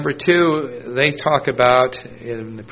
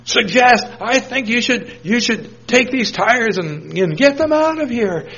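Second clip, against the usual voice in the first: high, loud mock bluster; deflated man's reply; 10 semitones higher, 4 dB louder than usual. Deflated man grudgingly telling them to remove tires, high mock voice. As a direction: voice the grumpy man, high and pushy. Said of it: "that just totally deflated him".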